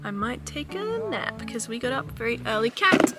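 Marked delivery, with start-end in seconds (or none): 2.7-3.1 excited. Real, not acted. Spots a cat, excited.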